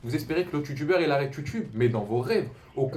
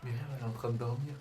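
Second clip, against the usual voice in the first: whispering over a crib; 8 dB quieter, faster than usual. Real, not acted. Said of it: "On va pas parler trop fort".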